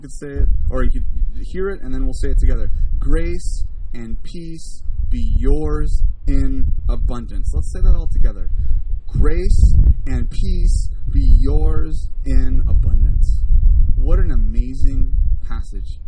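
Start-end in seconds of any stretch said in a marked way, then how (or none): 3.0-7.5 very slow, word-by-word verse recitation; solemnly measured, drawn-out reading. Shown as a demonstration. Recites verse for congregation to repeat. 9.1-13.4 slow, loud congregational recitation; drawn out and projected for all to join; leading group recitation. Shown as a demonstration. Leads congregation saying verse together.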